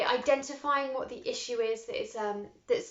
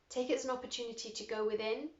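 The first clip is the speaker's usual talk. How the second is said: ordinary talk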